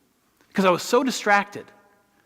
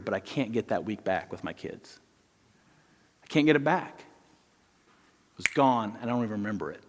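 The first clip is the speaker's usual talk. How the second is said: quiet regret; 8 semitones lower, 9 dB quieter than usual. Real, not acted. Confessing lost week with kids.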